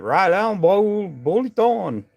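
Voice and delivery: badly faked Cajun accent; attempted Cajun accent. Shown as a demonstration. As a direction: stretch the name out in a mock Cajun accent. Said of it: "try to say it in my Cajun accent".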